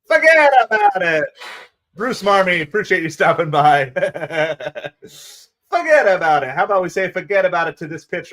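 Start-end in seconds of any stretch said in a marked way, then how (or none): 0.1-1.2 high, loud New York "fuggedaboutit"; hammed-up New York accent. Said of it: "the real New Yorker accent". 5.7-6.5 lightly voiced New York "forget about it"; a hint of a New York accent. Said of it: "the real New Yorker accent".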